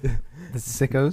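"sickos" drawn out slowly with a lisp. Shown as a demonstration. YouTuber showing how to say his fans' name, lisped "sickos". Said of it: "You gotta have a little lisp".

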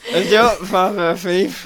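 loud, slow, slurred drunk pickup attempt. Trying the thief line while intoxicated, drunk and slurring. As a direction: drag the words out as if intoxicated, half laughing. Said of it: "especially if you're intoxicated".

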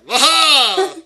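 loud, high, drawn-out "waha"; villain's laugh. Acted. Villain springing from the shadows, triumphant laugh. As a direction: burst out with a theatrical villain laugh.

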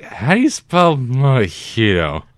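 slow, loud, dopey question; drawn-out bewildered asking; clueless drunk voice. Retold or imitated. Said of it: "Is basically how they said you were saying it".

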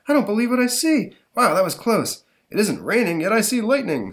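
lightly voiced gawking bystander; amazed bystander. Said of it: "says a, uh, a dude".

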